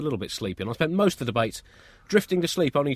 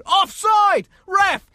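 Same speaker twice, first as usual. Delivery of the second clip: high, loud appeal to the ref; shouting offside; 8 dB louder, much slower than usual. Retold or imitated. Parent screaming offside at the referee, loud. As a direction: yell the call across the pitch. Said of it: "You're probably gonna hear them say".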